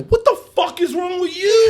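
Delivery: high, loud yell at the cop; retold yell. Shouting at the cop who pulled a gun, mock yell. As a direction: yell it like the gun is still on you.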